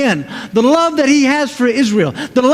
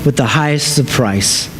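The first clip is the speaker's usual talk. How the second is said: gentle Jesus promising; 9 semitones lower than usual. Acted. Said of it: "And so Jesus says to us".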